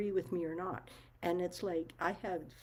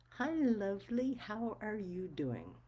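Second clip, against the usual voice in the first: higher, sing-song Hi and a slow drawn-out question; mimicking a sugary greeting; 5 semitones higher, slower than usual. Mocking the woman who keeps calling her Lovely, sugary. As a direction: parrot her syrupy hello back mockingly.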